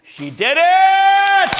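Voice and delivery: high, loud, drawn-out cheer; real cheer. Real, not acted. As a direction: celebrate her win out loud. Student succeeds, teacher cheers.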